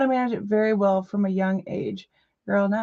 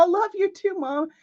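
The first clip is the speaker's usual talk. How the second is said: ordinary delivery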